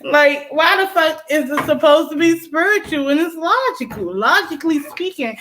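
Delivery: pressing the point hard; exasperated outburst. Real, not acted. Exasperated rant.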